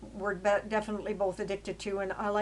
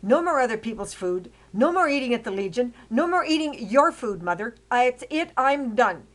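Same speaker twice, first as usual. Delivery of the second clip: loud, firm, hitting each more; laying down the law, louder and higher; angry ultimatum; 3 semitones higher, 6 dB louder than usual. Retold or imitated. Telling her mother no more food, loud and angry. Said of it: "And I said".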